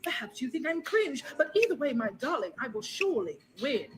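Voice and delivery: put-on comic British accent over a beat. British turn in accent rap.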